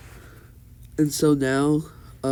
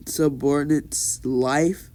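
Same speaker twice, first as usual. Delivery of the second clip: plain reading voice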